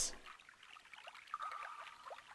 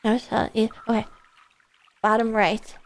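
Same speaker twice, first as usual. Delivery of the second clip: ordinary delivery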